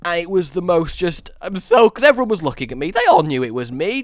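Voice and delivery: usual speaking voice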